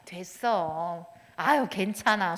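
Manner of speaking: slow, drawn-out polite no thanks; polite refusal. Retold or imitated. Mother politely refusing a birthday gift from her child. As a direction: decline out of politeness while secretly wanting it. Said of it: "예의상".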